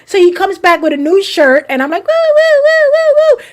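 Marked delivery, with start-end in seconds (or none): 2.1-3.4 string of high, loud whoops; playful woo noises. Retold or imitated. Aunt soaking the boy a second time, gleeful high whoops. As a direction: whoop loudly, spraying away in play. Spraying the boy with hose again.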